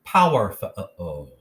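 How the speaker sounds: slow "Power, fa-a-o" with a full short U; stilted, over-literal "Power, fa-a-o"; syllables sounded out. Shown as a demonstration. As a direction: punch "Power", then spell out "fa-a-o" sound by sound. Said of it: "put all three syllables together".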